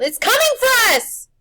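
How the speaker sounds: high, loud terrified shout; panicked yell. A narrator's reading. Boy warning his friend of the monster, panicked. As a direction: warn him in panic. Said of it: "Harvey yelled".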